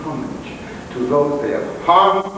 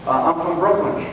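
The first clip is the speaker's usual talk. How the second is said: normal talking voice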